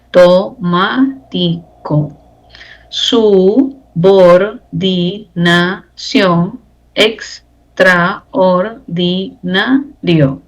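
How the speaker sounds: very slow reading. Shown as a demonstration. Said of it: "reading words for syllable count".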